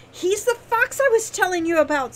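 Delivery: high, loud, delighted announcement; spring the reveal with glee; gleeful. A narrator's reading. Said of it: "said Wren with glee".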